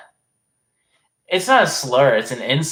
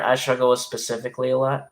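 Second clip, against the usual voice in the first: usual speaking voice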